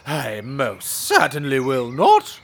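high, loud and slow haughty protest; indignant posh servant. Acted. Servant outraged at highwaymen, haughty and loud. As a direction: sniff down at the robbers.

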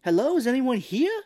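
high, loud mock calling out; searching the house in a put-on high voice; Mark Wahlberg. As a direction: call questioningly, comically high. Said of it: "trying to find her".

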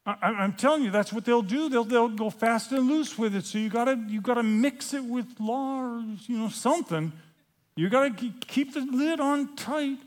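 high-pitched, stammering nagging; fretful warning. Acted. Judaizers warning Paul about grace, fretful and high. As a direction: plead anxiously, pitch climbing to the end.

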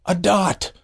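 high astonished burst; incredulous exclaim. Real, not acted. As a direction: marvel at how small. Exclaiming the tiny size.

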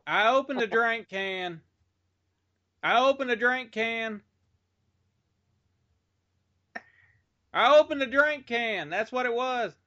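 high-pitched, slow shouted explanation; calling out. Real, not acted. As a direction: call it out high and drawn out to someone far away. Repeats answer to someone off mic.

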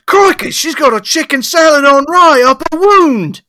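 high, loud Australian holler; Australian police accent. Acted. Australian cop redoing his discovery line, shouted in accent. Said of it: "the Australian police".

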